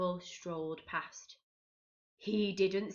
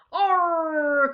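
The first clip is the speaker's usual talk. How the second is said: loud, higher "Arr"; pirate cry; 8 semitones higher, 10 dB louder than usual. A narrator's reading. Captain finds the barrel empty, loud higher Arr. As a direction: cry out in dismay. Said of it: "cried the captain".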